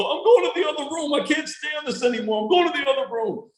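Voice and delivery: high, fast grumbling grandpa; dad's voice. Retold or imitated. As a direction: pitch up and rush it, fed up. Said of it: "I'll have to come in or go".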